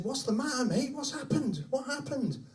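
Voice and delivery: high-pitched worried questioning; acted parental alarm; alarmed father. Father rushes out to crying son.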